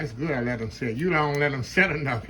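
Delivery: normal talking voice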